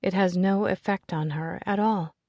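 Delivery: ordinary delivery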